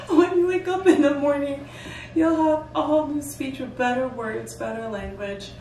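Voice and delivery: laughing, loud mock helper promise; quoting a helper while laughing. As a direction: keep laughing through the quote. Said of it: "somebody goes".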